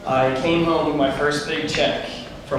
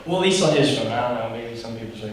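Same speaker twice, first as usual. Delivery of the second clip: usual speaking voice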